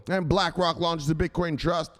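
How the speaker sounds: usual speaking voice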